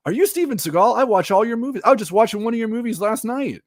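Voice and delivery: high, loud, gushing fan voice; starstruck fan. Retold or imitated. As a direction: play an excited fan who met Seagal. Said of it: "And be like".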